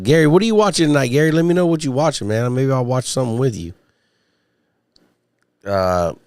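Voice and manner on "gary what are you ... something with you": high, fast, sing-song hail to Gary; calling out to a viewer. Real, not acted. Host hailing chat regular Gary, excited and fast.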